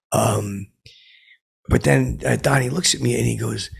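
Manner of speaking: usual speaking voice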